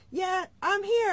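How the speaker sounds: Southern accent imitation. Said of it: "he's got this thick accent".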